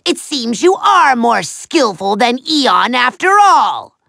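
usual speaking voice

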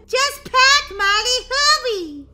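urgent shout. Acted. Daisy yelling at Molly to hurry packing, loud and urgent. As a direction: push her to move now. Sister urges her to hurry.

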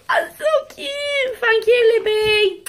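high, crying squeal of that's so cute; overwhelmed cute squeal. Real, not acted. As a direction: squeal it, almost in tears of joy.

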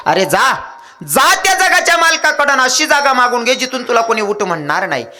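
high, ringing acted exhortation; mother's urgent command. Mother sends her son to the Lord of the world, high urgent command. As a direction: send the boy off with a long high cry.

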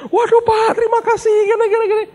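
high, loud, laughing thank-you; gushing wife. Retold or imitated. Wife thanking the pastor after the prayer, high and gushing. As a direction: squeal your thanks like a relieved wife.

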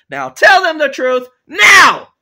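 very loud, high, slow command; screamed order. Acted. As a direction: bellow the order, dragging out the last word. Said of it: "I have to scream at you".

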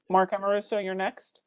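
usual speaking voice

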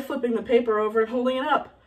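usual speaking voice

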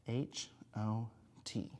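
letters spelled out slowly and softly; slow spelling. Shown as a demonstration. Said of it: "Just check it".

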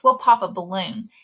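usual speaking voice

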